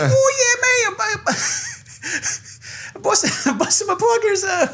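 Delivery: very high, exaggerated Australian accent; hammed-up Aussie guy. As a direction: ham up an Aussie bloke, high-pitched. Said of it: "the Aussie guys would be like".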